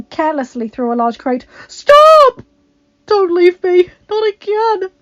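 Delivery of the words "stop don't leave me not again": desperate plea. A narrator's reading. Adam begging his vanishing mother.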